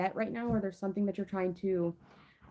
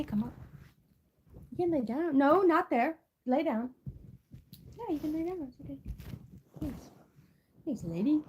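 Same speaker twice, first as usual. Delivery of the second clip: higher, sing-song voice for the dog; high coaxing pet talk; 5 semitones higher, faster than usual. Real, not acted. Talks to her pet.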